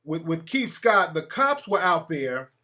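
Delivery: ordinary delivery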